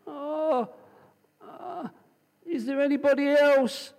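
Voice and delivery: high, hesitant, fumbling question; hesitant comic plea. Acted. The hanging man, reluctant to let go, asks God for someone else, high and hesitant. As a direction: stall nervously, then ask for someone else. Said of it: "And he hangs on and goes".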